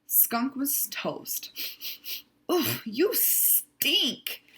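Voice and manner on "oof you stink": high, slow disgusted groan; disgusted animal. Acted. Animal disgusted at smelly Skunk, high and slow. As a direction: wrinkle the nose at the smell.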